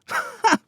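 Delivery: high scoffing laugh; a quick dismissive laugh. A narrator's reading. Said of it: "Gus laughed".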